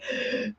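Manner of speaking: short soft gasp for breath; quiet intake, imitating oneself; imitated gasp. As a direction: demonstrate catching your breath. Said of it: "edit out each, like".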